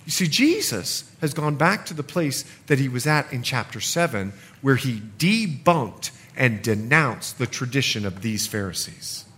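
usual speaking voice

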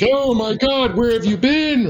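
high, frantic mock-relief; worried family. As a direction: shriek it like the relieved, panicked family. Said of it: "everybody's like".

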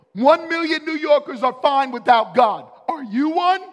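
billboard line shouted high and loud; loud proclamation. Retold or imitated. Preacher shouting the atheist billboard at the church, loud and high. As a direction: blast it like it's on every wall. It was everywhere.

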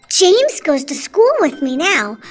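high, loud, bubbly enthusiasm; excited. Real, not acted. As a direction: build to a delighted high peak. Child guest enthuses about the story.

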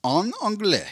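put-on a high, lilting en anglais in a French accent; mock French accent. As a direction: toss it off Parisian-style. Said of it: "I said en anglais".

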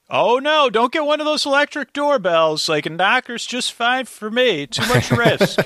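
high, loud fussy mom impression; mock mother voice. Retelling a mother's fear of doorbells, mock fussy high mom voice. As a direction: play up a worried mother's fussing.

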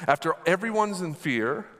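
ordinary delivery

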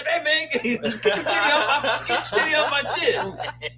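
hammed-up high movie line, laughing; movie quote. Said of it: "the Friday After Next when".

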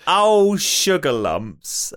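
very slow, lightly posh exclamation; a dainty lady drawing out her dismay; posh student voice. Retold or imitated. Quoting the posh student, drawn out slowly. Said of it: "then she went".

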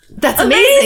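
high, loud burst of delight; excited amazement. Acted. Coach reacting to parents' one-outfit news, excited amazement. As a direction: exclaim it with a laughing lift.

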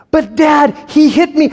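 put-on high, pleading kid voice; whiny child. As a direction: tattle to dad in a high whine. Child tattling to dad.

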